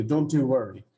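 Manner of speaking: ordinary talk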